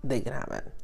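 ordinary delivery

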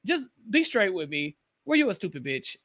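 usual speaking voice

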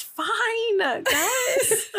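high laughing reassurance. Real, not acted. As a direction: giggle it out, voice going up. Laughing at coworkers story.

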